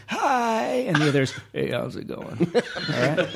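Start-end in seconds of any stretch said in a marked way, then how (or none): 0.1-0.8 put-on high-pitched old man greeting; drawn-out, high old man hello. Shown as a demonstration. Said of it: "I have two old men. One is". 1.5-3.3 put-on old man. Shown as a demonstration. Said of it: "That's more middle-aged man".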